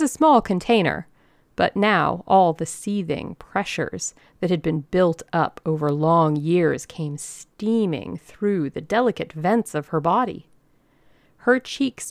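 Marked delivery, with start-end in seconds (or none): none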